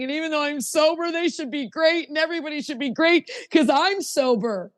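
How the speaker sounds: high-pitched mocking entitled voice; put-on high voice, mock expectation; mock entitlement. Mimicking entitled sober person's expectations.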